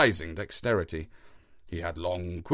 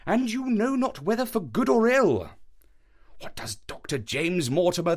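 higher, louder, excited detective; Holmes voice; 7 semitones higher, 3 dB louder than usual. A narrator's reading. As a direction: Holmes building the dramatic moment. Holmes voice in read story.

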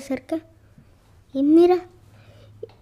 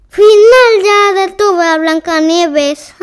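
evil witch voice; 4 semitones higher, 8 dB louder, slower than usual. Acted. Evil witch gloats after poisoning.

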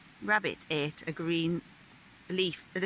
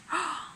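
gasp; 13 semitones higher than usual. A narrator's reading. Rabbit suddenly remembers.

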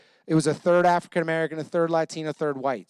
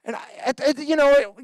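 ordinary talk

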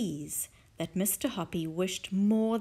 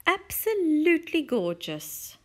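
insincere; 7 semitones higher, 5 dB louder than usual. Acted. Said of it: "not meaning it".

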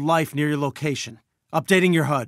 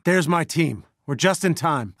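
normal talking voice

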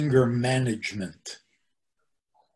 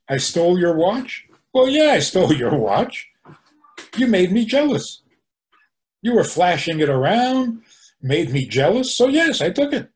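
higher, louder, indignant self-justification; mock defiant confession; 6 semitones higher, 5 dB louder than usual. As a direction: play a brazen thief justifying himself. Acting out unapologetic thief's confession.

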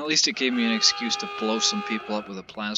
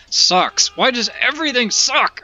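yelling, much higher and louder, slowed and stretched; furious shout; 11 semitones higher, 10 dB louder than usual. Real, not acted. Gamer raging at the crashing game, furious yell. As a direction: vent at the broken game at full volume.

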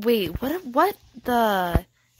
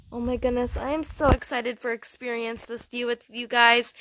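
ordinary talk

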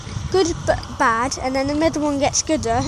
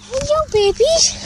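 slow, much higher coo; 12 semitones higher than usual. Real, not acted. Greeting the baby chicks in a slow high coo. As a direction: croon hello to the babies up high and slow.

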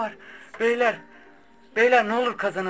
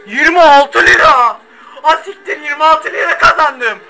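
crying laughing win; 5 semitones higher, 12 dB louder than usual. Real, not acted. Streamer tells viewers his prize, half crying, half laughing. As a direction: break into a sobbing laugh over the prize.

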